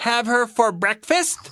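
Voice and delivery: high little bear voice; Baby Bear crying out. A narrator's reading. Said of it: "cried Baby Bear".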